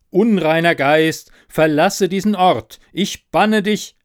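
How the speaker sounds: loud, slow, high incantation; solemn exorcism. Acted. Narrator banishes ghost from the room, loud solemn command. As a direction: proclaim it slowly like an exorcist banishing.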